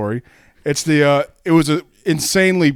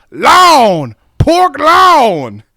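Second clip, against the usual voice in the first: loud, high shouted drawl; hammed-up drawling contestant yell; woman's drawl; 11 semitones higher, 11 dB louder, much slower than usual. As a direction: holler it in a heavy drawl, stretching the vowel. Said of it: "was it just her drawl".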